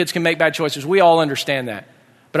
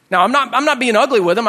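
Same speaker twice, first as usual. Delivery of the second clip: ordinary talk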